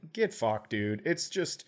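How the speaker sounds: normal talking voice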